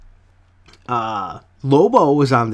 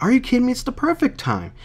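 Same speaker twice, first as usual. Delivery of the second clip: higher, lightly voiced incredulous girl; indignant teen; 12 semitones higher, faster than usual. Reviewer reads Sissy scoffing at the counselor, voice pitched up. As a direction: scoff at the bad timing.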